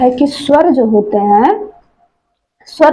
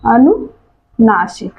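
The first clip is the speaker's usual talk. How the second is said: अनुनासिक drawn out slowly, syllable by syllable; अनुनासिक stretched out so students can repeat it; slow teaching repeat; much slower than usual. Shown as a demonstration. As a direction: spell out अनुनासिक slowly for the class.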